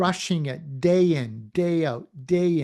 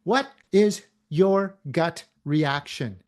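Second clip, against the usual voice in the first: ordinary talk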